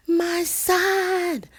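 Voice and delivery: wailing mother. Retold or imitated. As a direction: call out like a distraught mother. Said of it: "you hear Mama Gina's voice".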